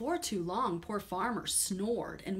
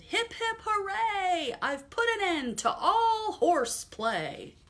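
high cheering shout, slower at the end; triumphant cheer; 12 semitones higher, 7 dB louder, slower than usual. Acted. Farmer celebrating his win over horses, high triumphant cheer. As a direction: whoop in victory. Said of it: "Farmer shouted".

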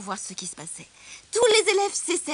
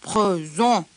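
murmuring lion; 3 semitones lower, 9 dB louder than usual. A narrator's reading. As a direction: answer as a lion trying to murmur, voice kept low. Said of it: "répondit le lion dans un murmure". (lion qui répond dans un murmure grave)

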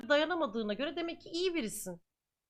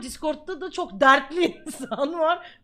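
laughing while talking; 6 dB louder, slower than usual. Real, not acted. Laughs mid-line about sad people.

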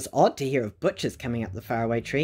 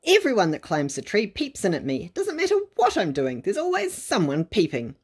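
pixie; 6 semitones higher than usual. A narrator's reading. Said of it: "an angry little face".

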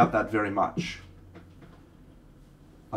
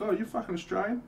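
mock surprise; 12 semitones higher, 4 dB quieter than usual. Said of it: "They were like".

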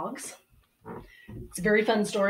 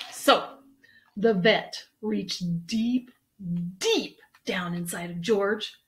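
plain reading voice

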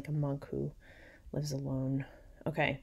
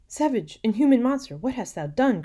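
high, loud accusing cry; horrified cry; 8 semitones higher, 9 dB louder than usual. A narrator's reading. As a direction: hurl it at a murderer. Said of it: "cried Theodore".

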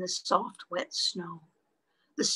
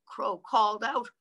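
ordinary delivery

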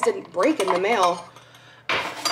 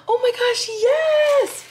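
very high, louder squeal of delight; thrilled; 4 dB louder, slower than usual. Real, not acted. Vlogger unwrapping a vase from her bestie, thrilled. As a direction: squeal it, building to a loud finish.

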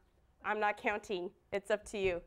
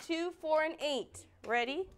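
ordinary talk, nothing marked

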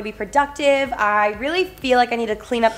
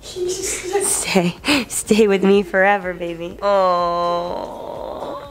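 cooing to puppy; 3 semitones lower, much slower than usual. Real, not acted.

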